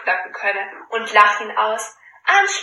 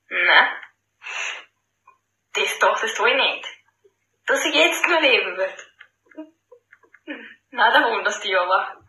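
smug smiling. Acted. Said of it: "Lächelt das Schneiderlein".

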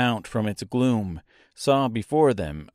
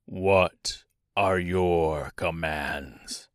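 low, very slow servant king; King of the Monkeys; 6 semitones lower, 3 dB quieter, much slower than usual. Acted. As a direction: ask for orders low and drawn out. Monkey king addresses the girl.

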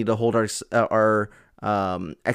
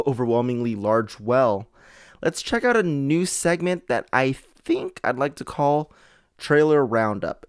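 ordinary talk

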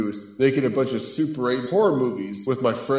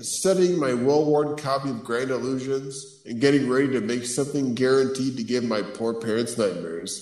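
ordinary talk

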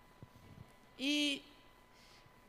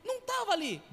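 high loud indignant outburst; mock angry shout about the van; 10 semitones higher, 6 dB louder than usual. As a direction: retell your shock and anger, much higher and louder. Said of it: "E eu fiquei bravo".

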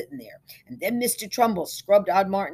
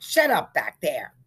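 stern rebuke; 6 dB louder than usual. A narrator's reading. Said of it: "Simpson frowned".

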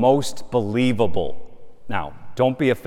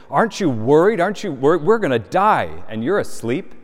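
higher and louder, frantic questions; panicked disciples; 6 semitones higher, 6 dB louder than usual. Acted. Disciples wake Jesus in the storm, panicked and louder. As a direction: cry out in fear that the boat will sink.

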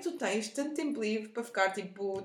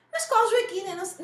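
high, loud affronted customer; indignant customer; 8 semitones higher, 8 dB louder than usual. Retold or imitated. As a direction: snap back like an offended caller. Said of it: "Não, mentira". (cliente indignada)

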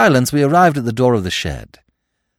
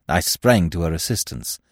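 usual speaking voice

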